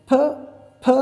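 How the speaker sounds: "फ" with a strong puff of breath; aspirated P. Shown as a demonstration. Teacher showing children the breathy P sound. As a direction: push air hard on "फ". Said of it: "फ बोलेंगे".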